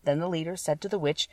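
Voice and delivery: ordinary delivery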